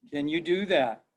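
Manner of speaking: ordinary talk, nothing marked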